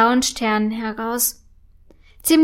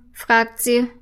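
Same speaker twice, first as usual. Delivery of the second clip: ordinary delivery, nothing marked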